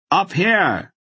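high, loud call down the stairs; shouted. A narrator's reading. Silk shouts down the stairway to Barak. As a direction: shout it down to be found. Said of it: "Silk shouted down the stairway".

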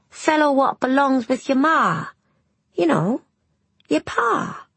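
put-on high boy's voice, explaining; exasperated boy. A narrator's reading. Boy explains a word to the puzzled girl, exasperated. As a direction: spell it out like an impatient lad.

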